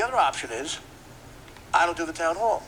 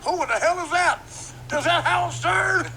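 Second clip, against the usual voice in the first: high, loud mock outrage; hammed-up shout of disbelief; astonished onlooker; 5 dB louder than usual. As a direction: holler it in a squawky put-on voice.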